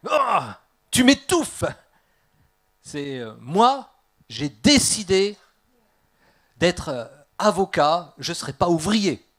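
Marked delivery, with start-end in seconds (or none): 0.1-1.6 loud, high exasperated outburst; teenage rebellion. Acted. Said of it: "il y a la rébellion en disant". 3.4-9.3 put-on loud, higher, defiant teenager; rebellious declaration. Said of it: "il y a la rébellion en disant".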